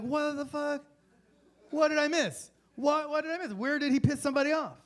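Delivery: high, loud disbelief; baffled outrage. Acted. Baffled follower asking why they killed him, exasperated.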